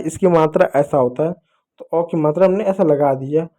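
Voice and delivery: ordinary talk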